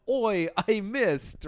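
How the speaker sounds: high, drawn-out mock Australian; hammed-up Aussie guy calling out slowly; bad Australian accent. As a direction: attempt a clumsy Australian accent. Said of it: "I can't do an Australian accent".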